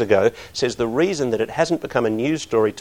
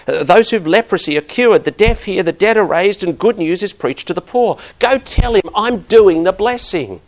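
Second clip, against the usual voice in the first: higher, louder scripture proclamation; preaching scripture; 6 semitones higher, 7 dB louder than usual. Acted. Pastor reading Jesus' reply to John, preaching fervently. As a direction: proclaim each line like good news.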